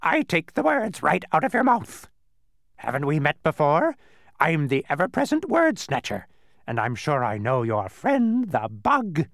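high, loud, cackly bird voice; loud bird squawk, full of itself; cackling bird. A narrator's reading. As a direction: boast in a screechy bird cackle. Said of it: "the bird cackled".

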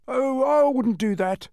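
slow, high drawn-out "oh"; hesitant giant, stretched out and high; thoughtful giant. A narrator's reading. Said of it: "said the giant thoughtfully".

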